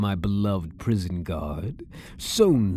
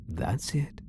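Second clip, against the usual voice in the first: soft, higher coaxing whisper; 5 semitones higher, 6 dB quieter than usual. Acted. Prisoner whispering encouragement as guard leans close. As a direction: coax him in with a breath.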